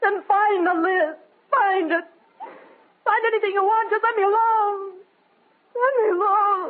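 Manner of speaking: high, crying plea to be left alone; sobbing outburst. Acted. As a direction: break down in tears, pleading. Widow breaks down sobbing.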